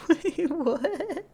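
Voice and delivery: high, laughing disbelief; baffled, laughing through the words; laughing confusion. Real, not acted. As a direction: crack up while asking. Laughing at an absurd question read out.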